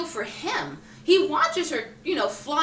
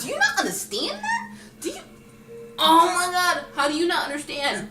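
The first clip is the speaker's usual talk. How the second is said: much higher, laughing disbelief; incredulous retort; 7 semitones higher than usual. Retold or imitated. Answering critics of the ending, laughing in high disbelief. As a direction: scoff at them, half laughing. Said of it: "And I was like".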